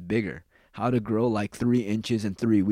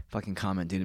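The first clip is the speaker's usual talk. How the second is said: normal talking voice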